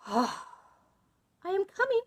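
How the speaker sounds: high, thin-stretched anxious answer; scared wife placating; frightened reply. A narrator's reading.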